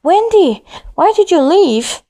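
high called-out question; shouting over wind. A narrator's reading. Brother shouts over the wind to his sister, calling. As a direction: call to her across the icy wind. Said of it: "He had to shout over the hard whistle".

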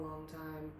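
ordinary delivery

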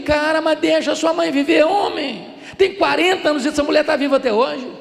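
loud, high comic exasperation; exasperated. Retold or imitated. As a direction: re-enact scolding a friend in disbelief. Acted exasperated reply to friend. (bronca exasperada e cômica, em voz alta)

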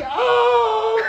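high laughing gasp of shock; a surprised, squeaky oh; startled oh. Retold or imitated. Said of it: "So I was like".

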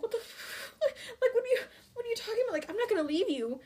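high-pitched, incredulous sputter with a laugh; imitating a stunned, protesting son; mock disbelief. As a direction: race through it in baffled protest. Voices Percy's disbelief at his mother.

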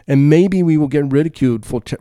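normal talking voice

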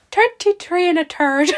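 slow, hammed-up Irish accent; a mock Irish lilt, drawn out. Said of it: "go a bit Irish".

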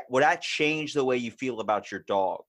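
ordinary talk

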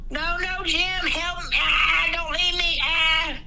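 hammed-up wife screaming for her husband; mock hysterical wail. Wife losing husband underground.

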